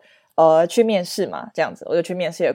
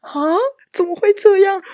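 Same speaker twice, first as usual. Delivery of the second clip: mock dismay; 12 semitones higher than usual. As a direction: wail it in disbelief. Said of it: "我想说".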